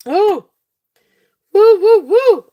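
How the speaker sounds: high, loud celebratory woo-hoos; excited whoops. Real, not acted. Seller overwhelmed by her buyers' support, excited whooping.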